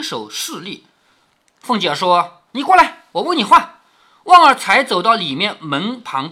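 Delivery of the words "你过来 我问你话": high, loud commanding tone; imperious, raised-voice command; stern summons. Acted. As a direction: order a servant over for questioning. Mistress summons a servant to question.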